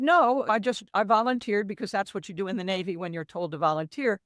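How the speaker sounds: ordinary talk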